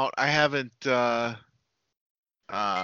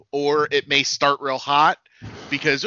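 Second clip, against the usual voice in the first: normal talking voice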